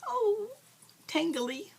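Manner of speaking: mock prim ladylike delivery. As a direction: put on a dainty lady act to complain. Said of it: "Is that, is that ladylike enough?".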